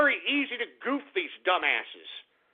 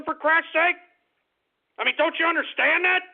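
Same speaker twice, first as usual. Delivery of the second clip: exasperated outburst; 7 semitones higher, 4 dB louder than usual. Real, not acted. Host exasperated at Bernie supporters.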